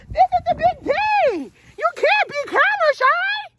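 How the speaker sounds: high coaxing voice to the chicken. Real, not acted. Owner coaxing a camera-shy chicken in high baby talk.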